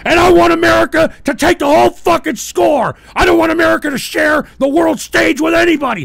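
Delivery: loud, high-pitched patriotic tirade; angry shouted rant. Real, not acted. Host rails at rival nations, angry shouting. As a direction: shout it in real outrage. Furious political rant on air.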